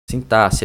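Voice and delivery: "Sintaxe" said sin-TA-si; double-S sound. Shown as a demonstration. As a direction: pronounce the X in "Sintaxe" as SS. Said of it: "como se fosse com dois S".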